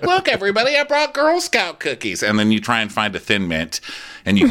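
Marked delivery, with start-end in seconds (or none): 0.0-2.2 fake cheerful. Herself showing off the cookies in a high, sugary fake voice. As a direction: announce it high and sweet, all put on.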